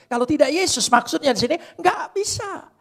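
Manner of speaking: high, loud, fast fiery sermon; preacher shouting high about suffering with Jesus; full-force preaching. Acted. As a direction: hammer each phrase at the congregation.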